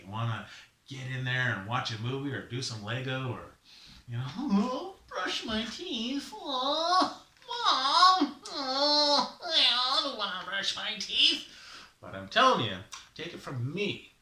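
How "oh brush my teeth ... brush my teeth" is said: high, loud, drawn-out mock whine; whining child. Child complains to Mom about brushing teeth, mock whining. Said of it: "I know sometimes, you know, you wanna go out".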